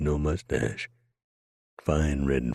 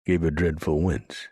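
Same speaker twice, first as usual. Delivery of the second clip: usual speaking voice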